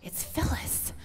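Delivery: high, breaking whisper of real emotion; tearful and nearly voiceless; getting emotional. Real, not acted. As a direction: squeeze it out through tears, voice gone high. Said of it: "I'm getting emotional".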